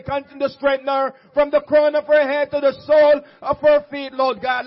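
reverent prayer, gradually building. Acted. Opening prayer to the congregation.

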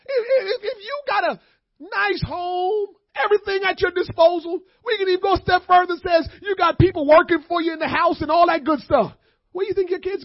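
loud, high shouted preaching; rising shouted sermon; full-force preaching. Acted.

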